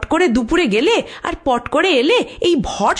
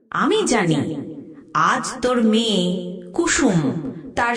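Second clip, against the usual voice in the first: a spirit murmuring doom into the ear, slowing at the end; ghost whisper; 2 semitones lower, 3 dB quieter than usual. Acted. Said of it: "কানে একটা কথা ফিসফিস করে বলে গেল". (ফিসফিসে ভৌতিক প্রেতের কণ্ঠ)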